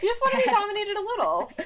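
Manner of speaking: high, fast, laughing; laughing tease. Real, not acted. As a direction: rattle it off laughing. Teasing laugh.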